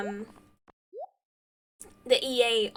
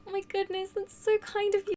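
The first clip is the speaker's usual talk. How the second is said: high, gushing thanks; grateful gush; 7 semitones higher than usual. Real, not acted. Streamer thanking a viewer for a new sub, grateful gush. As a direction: thank them in a high, touched voice.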